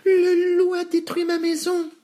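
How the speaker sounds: very high, panicky piglet squeal; panting piglet. A narrator's reading. Nif Nif fleeing to his brother, breathless alarm. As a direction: blurt it out as a tiny high-voiced pig. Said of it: "dit Nif Nif en haletant".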